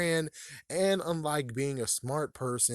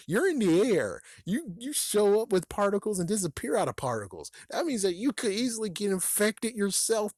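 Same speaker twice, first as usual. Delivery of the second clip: exasperated rant; 8 semitones higher than usual. Real, not acted. Ranting at the ghost who must touch first, exasperated.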